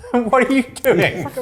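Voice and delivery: high, loud question through laughter; laughing disbelief. Real, not acted. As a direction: crack up while asking in disbelief. Cracking up at co-host mid-intro.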